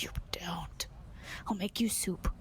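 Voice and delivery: low, soft retold threat; mock menace. Turtle lover warning people who like turtle soup, mock menacing. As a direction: play at threatening them under your breath.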